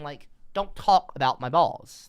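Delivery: ordinary talk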